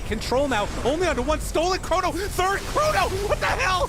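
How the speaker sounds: excited high-pitched esports casting; play-by-play. Acted. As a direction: call the fight live, rising hype. Live casting of game end.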